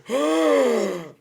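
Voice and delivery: loud, high gasp; big hammed-up gasp; mock shocked gasp. Locals hearing the your mom comeback, shocked gasp.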